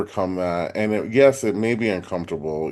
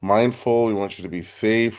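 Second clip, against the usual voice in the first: ordinary delivery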